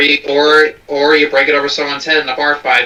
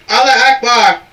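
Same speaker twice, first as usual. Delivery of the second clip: mock yell; 7 semitones higher, 4 dB louder than usual. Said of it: "someone running in and yelling".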